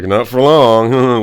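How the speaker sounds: loud, drawn-out taunt; jeering retort. Acted. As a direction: gloat at the happy couple, stretching it out. Jeering at happy couples.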